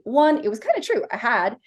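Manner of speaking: ordinary talk, nothing marked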